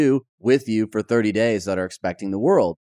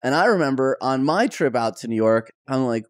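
ordinary delivery

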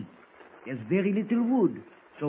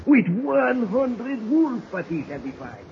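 boastful; 2 semitones higher, 6 dB louder, slower than usual. Acted. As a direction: draw it out, proud and loud. Baptiste boasts of fight.

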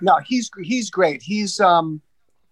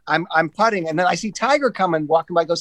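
ordinary talk

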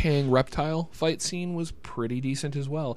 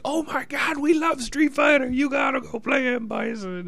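put-on excited kids shouting. Kids begging their father.

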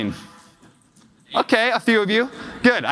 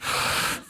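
long, voiceless huff of breath; heavy exhale, acted; imitated child's sigh; 7 dB quieter than usual. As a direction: sigh out like a worn-out frustrated kid. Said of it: "And she's like".

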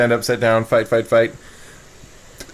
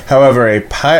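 ordinary delivery

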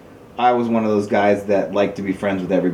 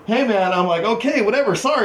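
mock placating; 9 semitones higher than usual. Backing down, retold.

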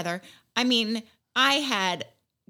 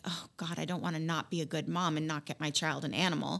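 much softer, guilt-ridden aside; guilty murmur; 4 semitones lower, 7 dB quieter than usual. Retold or imitated. New mom worrying to herself at night, hushed. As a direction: murmur it to yourself in bed. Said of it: "I'm like".

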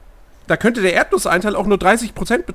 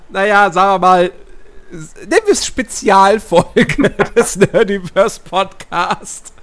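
laughing; slower than usual. Real, not acted. Laughing through podcast intro.

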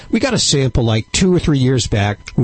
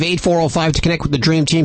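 normal talking voice

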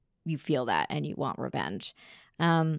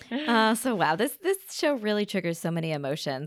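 usual speaking voice

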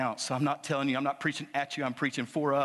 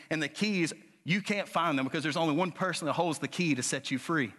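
preaching at full force; 5 semitones higher than usual. Acted. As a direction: build the sermon to a fiery peak.

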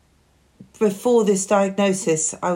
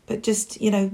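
usual speaking voice

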